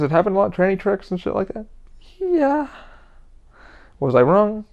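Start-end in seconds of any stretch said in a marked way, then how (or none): 2.2-2.7 sarcastic high yeah. Real, not acted. Answering his own rhetorical question.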